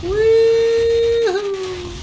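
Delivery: high sustained whoop; excited whoop. Real, not acted. Gamer whooping on a last-second run, high cheer. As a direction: cheer loud and long.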